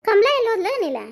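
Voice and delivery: high, loud household call. Acted. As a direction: call out to find someone in the house. Calling out for absent household member.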